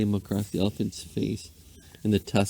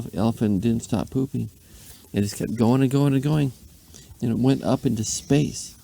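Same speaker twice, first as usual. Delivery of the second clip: plain reading voice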